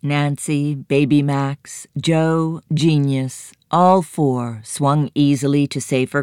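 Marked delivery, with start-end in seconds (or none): none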